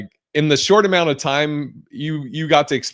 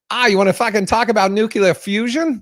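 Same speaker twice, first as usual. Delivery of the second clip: higher, brash mock challenge; tough Boston woman; 9 semitones higher than usual. Smart Boston woman challenging you, brash higher mock voice. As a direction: throw it out like a rough local. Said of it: "it'll be like".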